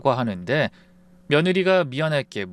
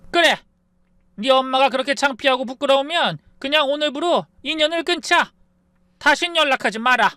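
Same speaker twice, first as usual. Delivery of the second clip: very high, loud furious outburst; furious shout; 12 semitones higher, 3 dB louder than usual. Acted. Mother disowning her daughter over the phone, furious high shout. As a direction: cut her off for good, screaming.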